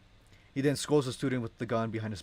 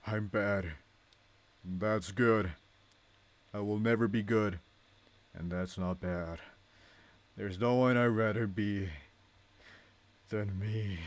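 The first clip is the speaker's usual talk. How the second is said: dying speech; 2 semitones lower, slower than usual. Acted. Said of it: "his final speech, which is directly quoted".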